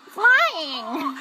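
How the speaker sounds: squawky cartoon parrot. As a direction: screech it like a parrot. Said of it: "My parrot voice is on fleek".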